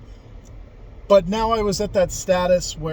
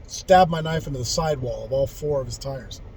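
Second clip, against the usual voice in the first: ordinary talk, nothing marked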